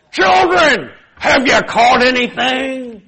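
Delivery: high, slow put-on old man. Jesus disguised calls to the disciples, as an old man. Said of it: "to be like an old man".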